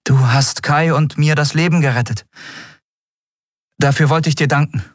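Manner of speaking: high voice, a touch sheepish; mildly self-conscious gratitude; slightly embarrassed. A narrator's reading. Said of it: "diesmal etwas verlegen".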